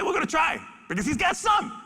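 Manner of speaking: high, bright hopeful call; rally the team with hope; hopeful cheer. Real, not acted. Zoo keeper cheering on an old tiger, excited.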